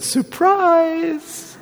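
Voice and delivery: high, bright exclaimed Surprise; a playful high-pitched angel springing the news; angel's surprise. Pretend. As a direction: pop out the word like a joyful angel. Angel announcing surprise to Mary.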